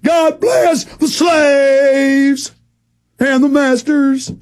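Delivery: mocking, slow, high hammed-up patriot voice; sarcastic proud patriot. Host mocking a loyal citizen with a slow sarcastic shout. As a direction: sneer it like a brainwashed flag-waver. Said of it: "and you'll go".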